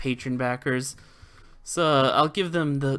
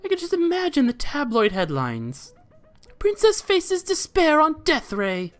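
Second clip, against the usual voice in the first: villain voice; 13 semitones higher than usual. Acted. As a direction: savour the headline like a villain. Villain reading dialogue.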